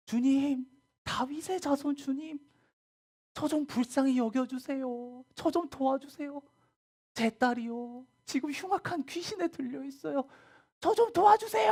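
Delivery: high, loud desperate calling out; a mother shouting high and loud to Jesus for her daughter; crying out for help. Acted. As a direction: cry out across the crowd begging for help. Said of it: "소리 지르면서 예수님을".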